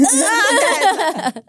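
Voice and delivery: loud, high mock-panicked squeal with laughter; high-pitched panic noise, breaking into laughter; nervous inner squeal. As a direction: let out a comic squeal of nerves. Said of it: "really in my stomach, I'm like".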